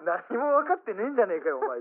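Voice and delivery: hammed-up indignant complaint; retold telling-off. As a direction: act out an exasperated rebuke.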